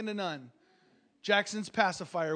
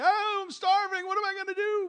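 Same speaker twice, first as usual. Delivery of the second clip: mock whiny cry; 12 semitones higher than usual. Preacher mocks a crying millionaire, high whiny wail. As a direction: ham up a pitiful rich man.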